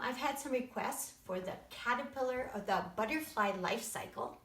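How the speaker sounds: usual speaking voice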